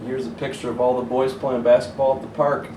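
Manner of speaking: plain reading voice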